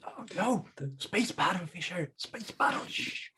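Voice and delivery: hushed urgent whisper. Real, not acted. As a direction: whisper to keep him quiet. Hushing friend.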